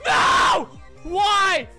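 high furious scream; angry yell. Real, not acted. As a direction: scream out at the loss. Raging at loss.

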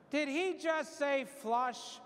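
high, slow, incredulous double take; mock surprise. Priest voicing a startled congregant, drawn-out mock disbelief. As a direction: play the shocked listener who can't believe the word. Imagined listener reacting to "flush.

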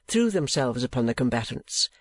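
usual speaking voice

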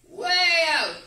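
excited exclaim; emphatic. Retold or imitated. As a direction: throw the words out with gusto. Islander describes his remote home.

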